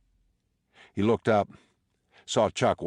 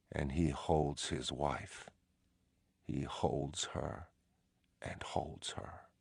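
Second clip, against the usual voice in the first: slow narration softening to a whisper; fading to a whisper; 10 dB quieter, slower than usual. A narrator's reading. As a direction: let the line die away into breath.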